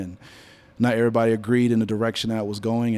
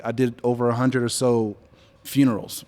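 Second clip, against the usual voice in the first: ordinary talk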